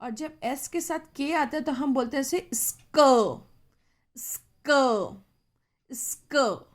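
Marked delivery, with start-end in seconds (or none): 4.2-5.2 स्क drawn out slowly, the क held long; long sound. Shown as a demonstration. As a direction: stretch स्क out so the class hears the long क. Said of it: "long sound". (स्क को लंबा खींचकर बोलना)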